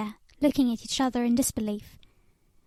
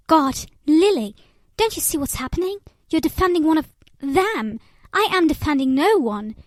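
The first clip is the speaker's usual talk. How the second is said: louder and higher, a heated cry of frustration; a furious plea, voice raised and pushing hard; angry outburst; 4 semitones higher, 6 dB louder than usual. A narrator's reading. Jerome yelling at Lilat after the quarrel, in a raised angry voice. Stableman shouting at friend.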